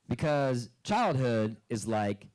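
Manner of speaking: ordinary delivery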